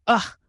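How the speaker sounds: high straining ugh; frustrated grunt. A narrator's reading. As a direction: grunt with effort. Straining to push him.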